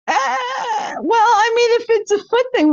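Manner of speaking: high, cracking laugh through the words; high, squealing laughter over defensive words; laughing fit. Real, not acted. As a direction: try to talk while laughing hard. Laughing fit while defending herself.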